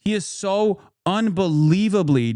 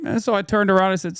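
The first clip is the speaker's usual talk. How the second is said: ordinary talk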